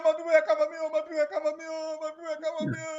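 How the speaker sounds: high wailing shout; shouting for help. Acted. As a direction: cry out high to your people. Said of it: "Spada started shouting".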